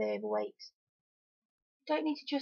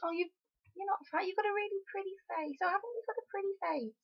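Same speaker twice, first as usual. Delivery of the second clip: imitating a patronising friend, higher and softer; sweet, condescending fake reassurance; high sugary mock compliment; 7 semitones higher, 3 dB quieter than usual. Friend reassuring a bigger woman, in a higher, softer, sugary mock tone. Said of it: "that whole pretty face bloody thing".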